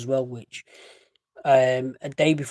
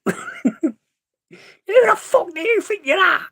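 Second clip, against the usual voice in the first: very high, loud mock Salford accent; hammed-up aggressive Salford challenge; 5 dB louder, slower than usual. Mimicking Salford people picking a fight, hammed-up Salford accent. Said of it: "when you listen to Salford people".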